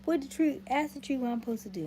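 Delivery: loud, high mock plea; mock pleading viewers. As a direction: shout it like pestering fans begging. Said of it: "until y'all be like".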